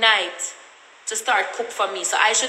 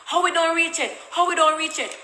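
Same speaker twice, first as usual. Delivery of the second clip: higher, sing-song mock question; mocking commenters; 6 semitones higher than usual. Imitating nosy commenters online, higher mocking whine. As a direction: parrot the comment section, pitched up and teasing. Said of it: "everybody in the comment section".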